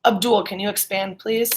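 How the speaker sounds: ordinary talk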